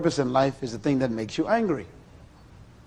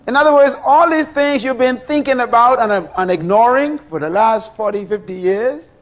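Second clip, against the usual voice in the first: rising emphasis; 8 semitones higher, 6 dB louder than usual. Real, not acted. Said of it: "preacher builds revelation".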